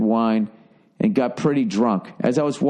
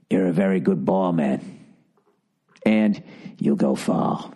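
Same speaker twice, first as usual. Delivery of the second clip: bad British accent; 6 semitones lower than usual. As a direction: put on a clumsy deep British accent. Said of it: "Sorry with the bad accent".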